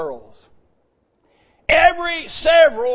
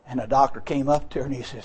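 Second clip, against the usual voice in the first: ordinary talk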